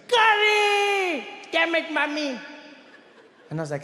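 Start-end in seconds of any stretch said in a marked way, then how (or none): 0.1-1.3 high, loud drawn-out scream. Retold or imitated. Boy screaming at his sandwich, loud high scream. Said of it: "He screams like". 1.5-2.4 whiny frustrated cry. Retold or imitated. Boy cursing his lunch, high whiny complaint. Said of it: "He screams like".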